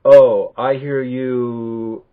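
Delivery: very slow, drawn-out, loud mock empathy; wrong-way demo. Shown as a demonstration. Teacher showing the I statement not to use, exaggerated slow empathy. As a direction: stretch it out as overdone active listening. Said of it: "So I'm not saying".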